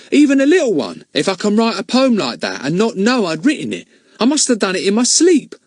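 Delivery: put-on high eager boy's voice; wide-eyed, proud and puzzled; Ern marveling. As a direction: Ern wonders to Betts if he is a genius. Ern's voice in the story.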